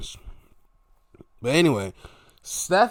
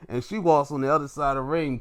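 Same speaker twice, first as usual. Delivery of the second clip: ordinary delivery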